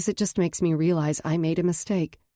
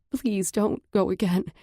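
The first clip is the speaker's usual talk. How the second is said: trembling voice; 4 semitones higher than usual. Acted. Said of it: "my voice trembling".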